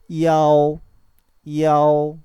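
이아오 loud and long, three vowels run into one glide; pronunciation model. Shown as a demonstration. Teacher answers with 이아오, loud and sustained. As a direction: project 이아오 slowly on a level pitch. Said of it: "이 결합되니까 뭡니까?".

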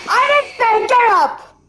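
loud repeat. Real, not acted. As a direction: shout it the second time with force on didnt.

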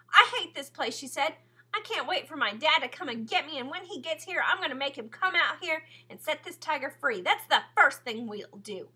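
high, loud angry outburst; angry girl shouting; furious. A narrator's reading. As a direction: rage against the place. Sistine furious at the cage.

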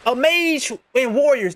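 ordinary talk

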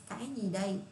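ordinary delivery

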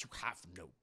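whispered shocked question. Retold or imitated. Henry whispering back in disbelief. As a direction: hush it out in disbelief. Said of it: "He's like".

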